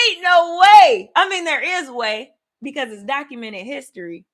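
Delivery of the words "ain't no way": "Ain't no way" drawn out slowly and much louder; "Ain't no way" as a loud, slow exclamation; loud disbelief. Shown as a demonstration. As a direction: belt out "Ain't no way" in astonishment. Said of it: "Like the slang version".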